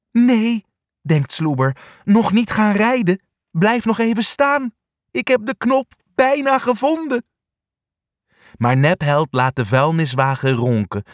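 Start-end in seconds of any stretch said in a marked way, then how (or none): 0.1-0.6 high, urgent inner cry; acted alarm, pitched high; anxious plea. A narrator's reading. Dog silently begs van to stay. 2.0-7.2 high, urgent inner cry; acted alarm, pitched high; anxious plea. A narrator's reading. Dog silently begs van to stay.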